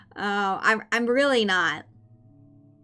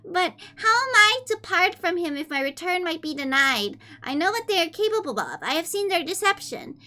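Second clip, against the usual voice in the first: put-on high, sweet anime-girl reading voice; fake cute voice; 5 semitones higher than usual. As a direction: read it cutesy and high-pitched. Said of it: "I'll read the first page in a kawaii voice".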